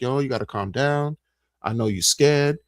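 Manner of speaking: softly spoken calm-down; calming supervisor. Retold or imitated. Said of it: "she was like".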